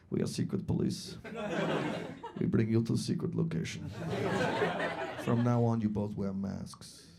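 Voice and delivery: deadpan Eastern European cop; unbothered menace in an Eastern European accent. Retold or imitated. Driver answers without skipping a beat, deadpan Eastern European accent. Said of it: "The cop driving the car without skipping a beat".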